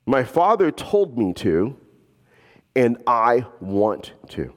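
slowed right down, each phrase weighed; slow and deliberate. Shown as a demonstration. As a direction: let it sink in, slowly. Same words repeated, slowed for weight.

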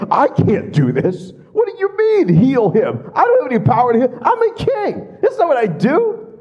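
high, loud whiny king voice; mocking a king who cannot heal, high and whiny; mocking panicked king. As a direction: play the king as silly and flustered. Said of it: "He's like".

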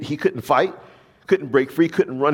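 ordinary talk, nothing marked

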